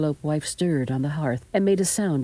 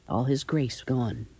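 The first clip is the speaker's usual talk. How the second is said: ordinary delivery, nothing marked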